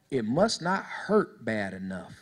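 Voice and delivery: put-on low, slow old man's verdict; old man professing. Old man answering the young man, slow strong profession. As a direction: deliver it low and drawn out, with conviction. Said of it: "Old man professed really strongly".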